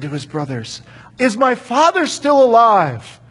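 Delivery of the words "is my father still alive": emotional. Acted. Joseph reveals himself, overwhelmed.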